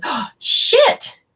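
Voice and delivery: shocked. Retold or imitated. Retelling a client's reaction, imitating her shocked outburst. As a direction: blurt it out stunned. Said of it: "She just was shocked".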